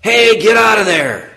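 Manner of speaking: a man yelling in a mall; retold yell. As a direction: call out like a guard chasing a thief. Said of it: "I heard a man yell".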